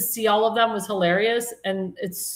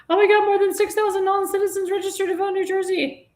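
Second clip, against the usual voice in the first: much higher, mocking fake-shocked voice; mocking Sherrill; 11 semitones higher than usual. Host mocks the governor in a high put-on shocked voice. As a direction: parody a governor feigning outrage. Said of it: "she's coming out like".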